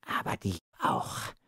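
soft, voiceless dying croak; groaning. A narrator's reading. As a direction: groan it out with failing breath. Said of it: "ächzte er".

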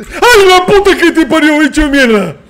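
high, loud panicked shriek; terrified scream. Real, not acted. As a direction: scream as the monster lunges. Monster jumps out in game.

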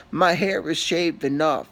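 normal talking voice

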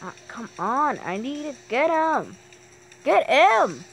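exasperated yelling. Real, not acted. Gamer who hates the aliens, exasperated yelling. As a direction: yell it out in annoyance at the enemy. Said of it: "Am I screaming too loud?".

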